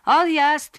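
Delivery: high, loud call; conductor's shout. Acted. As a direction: shout the departure to the whole bus. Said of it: "powtórzył konduktor".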